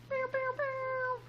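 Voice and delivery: high, soft mouth air horn; playful vocal air horn; air horn noise. Pretend. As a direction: mimic an air horn for fun. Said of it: "I'm trying to put air horns in here".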